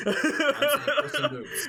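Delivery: high, loud excited shout; squealing with excitement. Real, not acted. Excited shout about the movie.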